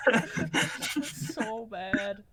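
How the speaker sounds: very slow, high, through laughter; can barely talk for laughing. Real, not acted. Laughing after imitation.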